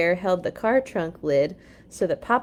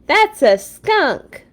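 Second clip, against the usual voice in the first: very slow, high, loud disgust; 8 semitones higher, 7 dB louder, much slower than usual. Acted. Brother telling Papa it's a skunk, slow and disgusted. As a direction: pinch your nose and drag it out. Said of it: "making a face".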